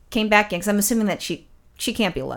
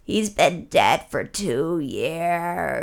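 lower, very slow and hushed; creepy old lady; 5 semitones lower, 3 dB quieter, much slower than usual. Retold or imitated. Old lady insists to Ron he is dead, slow and eerie. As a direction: drag every word out like a ghost story. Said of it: "kinda like a horror movie".

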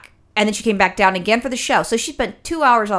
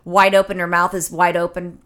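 ordinary talk

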